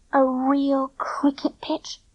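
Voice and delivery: slow reverent whisper; awed whisper. A narrator's reading. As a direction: breathe it in wonder. Whispered in awe.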